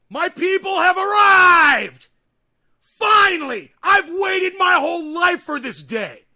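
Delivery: a triumphant bellow of welcome; ecstatic shout. Real, not acted. Streamer welcoming his viewers, a slow ecstatic shout. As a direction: yell it out, overjoyed, slow and huge. Overjoyed as viewers finally engage.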